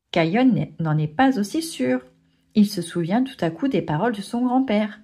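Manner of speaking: ordinary delivery, nothing marked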